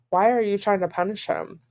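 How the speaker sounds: ordinary talk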